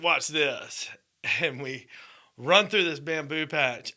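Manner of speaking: ordinary talk, nothing marked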